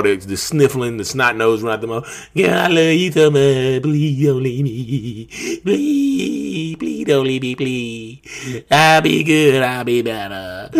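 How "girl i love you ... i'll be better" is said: whiny, pleading, slow mock sob; begging an ex back. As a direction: beg like a sniffling ex. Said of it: "the begging, the pleading, the crying".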